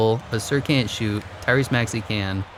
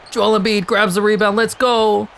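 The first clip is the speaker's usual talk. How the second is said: excited yell; 10 semitones higher, 5 dB louder than usual. Real, not acted. Excited about fast break.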